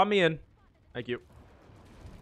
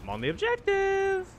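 singsong callout. Real, not acted. Objective callout, sung high.

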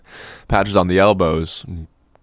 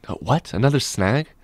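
exasperated surprise; 6 semitones higher than usual. A narrator's reading. Captain hears of another snag.

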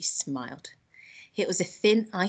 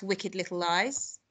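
plain reading voice

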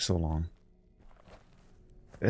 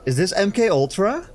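excited; 10 semitones higher, 6 dB louder than usual. Real, not acted. Excited hoping it is MK Ultra.

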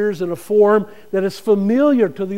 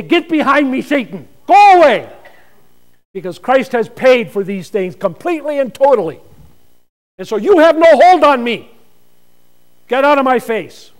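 loud, higher defiant shout; rebuking Satan; 5 semitones higher, 11 dB louder than usual. Acted. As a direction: drive the devil away with full force. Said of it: "You can say".

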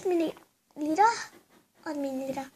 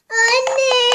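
loud, higher wailing cry; exaggerated child's crying wail; fake crying wail; 7 semitones higher, 11 dB louder, much slower than usual. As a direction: howl it out like a tantrum. Child cries, mother shouts back.

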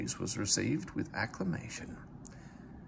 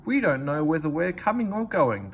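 higher, louder coach voices protesting; protesting coaches; 6 semitones higher, 6 dB louder than usual. A narrator's reading. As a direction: complain like weary coaches. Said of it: "they protested".

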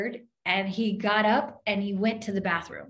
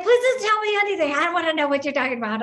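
high, loud and rushed, half laughing; begging him not to say more, higher and rushed; flustered plea; 8 semitones higher, 6 dB louder, much faster than usual. Retold or imitated. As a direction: blurt it out fast in a panic. Panicked plea to the man.

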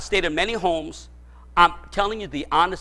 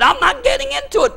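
much higher, emphatic refusal; defiant retort; 4 dB louder than usual. Retold or imitated. Pastor retelling his refusal to gossipers, high retort. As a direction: squeak out the refusal firmly. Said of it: "I said".

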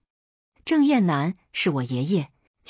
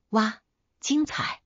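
mock wow; 4 semitones higher, slower than usual. Cheering a fight in her head.

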